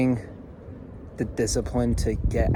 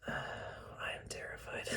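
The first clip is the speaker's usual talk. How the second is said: whisper; 17 dB quieter, slower than usual. Real, not acted. Whispered admission of fear.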